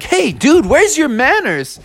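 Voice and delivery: high, affronted character voice; indignant scolding. Acted. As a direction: scold a badly behaved guest. Bear scolds after second banana.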